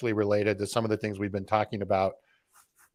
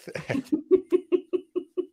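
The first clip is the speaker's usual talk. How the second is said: high-pitched giggle; helpless high chuckle; amused laugh. Real, not acted. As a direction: burst into a high, light laugh. Amused as the show wraps up.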